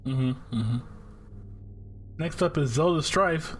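ordinary delivery

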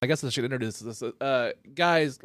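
ordinary talk

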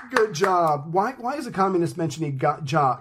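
ordinary delivery

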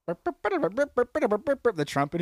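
high, drawn-out veteran's cry; flashback-stricken vet. Acted. Vet reliving the training bugle, high mock panic. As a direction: wail it as a panicked old soldier. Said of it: "a World War Two vet has flashbacks".

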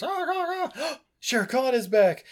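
high, gasping mock alarm; hammed-up high panic with a gasp; panicked animal warning. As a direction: squeal the warning like a frightened animal. Animals warn Mowgli of tiger.

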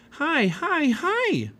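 very high, repeated squeals of greeting; excited call. A narrator's reading. Girl rushing to greet the cubs, excited call. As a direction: pitch it way up, calling gleefully. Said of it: "she called".

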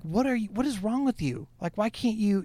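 imitating an exasperated what is wrong with you; exasperated disbelief. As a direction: act out being fed up with a troll. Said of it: "you're just like".

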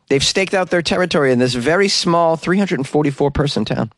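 ordinary talk, nothing marked

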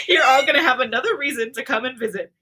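loud, high, giddy with real laughter; barely recovered from a laughing fit; laughing while reading. Real, not acted. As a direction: push through the line after cracking up. Reader resumes after cracking up.

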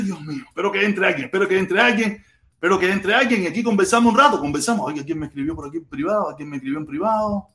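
usual speaking voice

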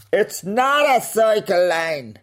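high, slow mocking imitation of a lady; mock lady's retort. Comedian mimicking the walker who blocked the cycle lane, high mock voice. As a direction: parrot her indignant reply, high and drawn out. Quoting the ladies on the path.

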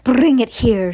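loud witch's order; shouted command. Acted. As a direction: bark it at the underlings. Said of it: "shouted the Grand High".

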